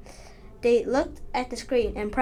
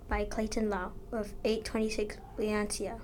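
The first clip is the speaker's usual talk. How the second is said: whispering; 7 dB quieter than usual. Real, not acted. Author credit read aloud.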